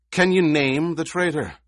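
rising anger, pitched well up; angry demand. A narrator's reading. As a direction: straighten up and demand an answer. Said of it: "Anger overcame part of Halleck's fatigue".

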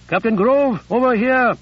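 shouted high across the parade ground; calling out. Acted. Corporal hailing the captain from across the parade ground, shouting. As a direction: call the captain over, loud and urgent.

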